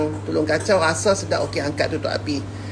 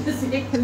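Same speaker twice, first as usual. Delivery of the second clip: short laugh; 9 semitones higher, 5 dB quieter than usual. Real, not acted. Laughs at own remark.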